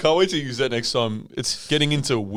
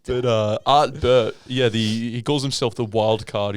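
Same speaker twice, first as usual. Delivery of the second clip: usual speaking voice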